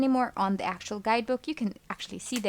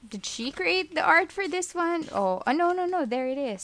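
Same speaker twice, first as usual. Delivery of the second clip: surprised; 8 semitones higher than usual. Real, not acted. Searching the card for the artist.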